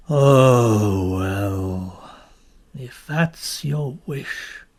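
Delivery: low, slow husky sand creature; the creature yields to the children, low and reluctant; grudging creature. Acted. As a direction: give in with a weary grumble.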